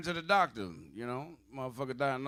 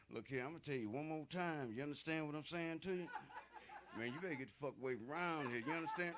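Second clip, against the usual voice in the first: cool dude's quiet warning; 11 dB quieter than usual. Retold or imitated. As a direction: menace them without raising the voice.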